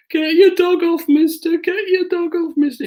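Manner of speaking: very high, wailing mock plea; pathetic whining. Suspect begs the handler to call off the dog, whining pathetically. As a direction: whine it like a pitiful suspect. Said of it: "in a really pathetic voice".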